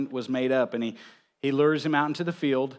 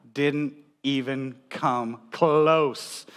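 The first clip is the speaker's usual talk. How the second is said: drawn out; much slower than usual. Acted. Punchline of dad timing story.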